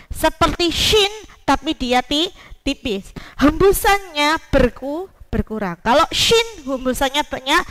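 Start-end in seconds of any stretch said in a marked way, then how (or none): none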